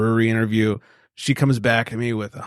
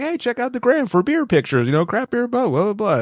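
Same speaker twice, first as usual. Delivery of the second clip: much higher, bright host plug voice; mock show plug; 11 semitones higher than usual. Host parodying his own on-air promo, pitched up. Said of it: "what I always say on the show".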